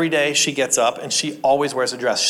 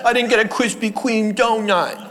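mock whine; 7 semitones higher than usual. Mocking petulant complaint.

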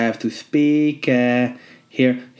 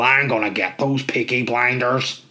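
Sam Neill impression. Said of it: "Sam Neill".

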